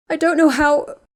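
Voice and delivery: high, loud, breaking through tears; struggling through sobs. A narrator's reading. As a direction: push it out between sobs, short of breath. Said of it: "broke off as she struggled to breathe".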